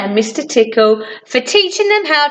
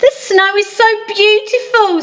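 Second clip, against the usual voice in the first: delighted; 9 semitones higher than usual. Acted. Sunshine delighted on her drive.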